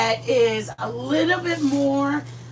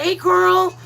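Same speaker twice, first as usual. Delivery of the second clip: ordinary talk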